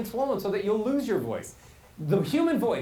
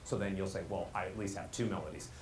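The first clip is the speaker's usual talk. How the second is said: low, soft droning on one note; monotone; 11 semitones lower, 10 dB quieter than usual. Shown as a demonstration. Coach voices people protesting "that's not me", in monotone. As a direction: keep every word on the same low note. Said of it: "it's the same melody".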